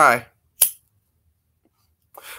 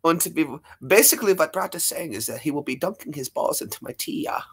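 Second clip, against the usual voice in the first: put-on light comic German accent; 2 semitones higher, 7 dB quieter than usual. Streamer obliging a request, in a German accent. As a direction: put on a thick-tongued German. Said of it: "he wants a German accent".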